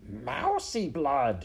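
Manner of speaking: high, drawn-out ghoulish cry; shouting rat. Acted. One rat guesses at the smell, ghoulish cry. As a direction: relish each word, slow and high. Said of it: "shouted one rat".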